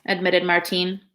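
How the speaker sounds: ordinary delivery, nothing marked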